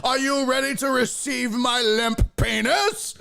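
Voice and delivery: very high, drawn-out mock outrage; hammed-up, ringing orator's protest; [name]. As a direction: cry out theatrically as if wrongly arrested. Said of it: "I'd be like".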